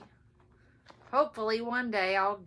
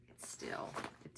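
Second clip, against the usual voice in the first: whispered; 10 dB quieter than usual. Real, not acted. Complaining about eye strain.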